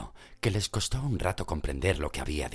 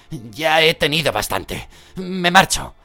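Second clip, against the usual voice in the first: high, loud exasperated cry; fed-up outburst; 11 semitones higher, 10 dB louder than usual. Acted. Ron shouting that he is leaving. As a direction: have had enough.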